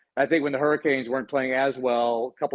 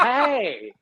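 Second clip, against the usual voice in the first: much higher game-show announcer holler; Will Ferrell's Harry Carey; 10 semitones higher than usual. Shown as a demonstration. Co-host showing his introduction voice, announcer impression. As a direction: belt it like a zany baseball announcer. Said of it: "it's like a Will Ferrell, Harry Carey".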